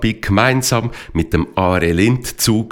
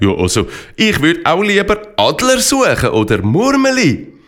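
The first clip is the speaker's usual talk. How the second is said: eager pony voice; 8 semitones higher than usual. Acted. Rinex voices his own wish.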